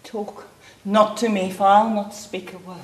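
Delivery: low, quiet rejection; cold refusal. Acted. Mother refusing her pleading daughter, low and cold. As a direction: shut the plea down in a low voice.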